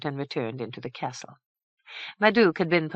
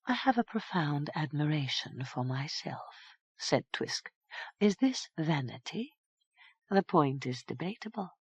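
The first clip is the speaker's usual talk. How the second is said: much softer, airy self-regard; lightly voiced vain fairy; 8 dB quieter than usual. A narrator's reading. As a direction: defend her vanity lightly. Twisk proud but soft.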